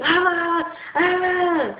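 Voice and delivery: high-pitched imitated yelling "ah"; mock yelling. Imitating family yelling at the speaker, mock yelling. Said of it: "they raise their voice to me".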